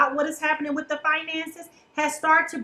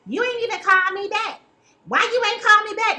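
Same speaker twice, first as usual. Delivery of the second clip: higher, louder accusing complaint; indignant accusation; 7 semitones higher, 4 dB louder than usual. Retold or imitated. Partner confronting them about the missed call, loud and indignant. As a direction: demand it like a jilted partner. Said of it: "and you like".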